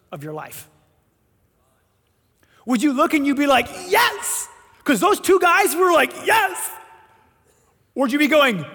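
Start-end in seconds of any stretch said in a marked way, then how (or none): 3.9-4.5 eager yes. Acted. Eager acceptance voiced. 6.2-6.7 high, loud excited yes; joyful loud yes; eager shouted yes. Acted. Two guys' eager yes.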